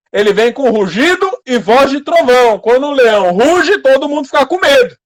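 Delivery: loud, high-pitched preaching; pregação em alta voz. Acted. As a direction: preach it at full force to the congregation. (pregação enérgica, em voz alta e aguda)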